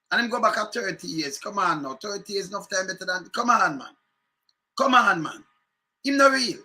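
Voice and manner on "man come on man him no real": ordinary talk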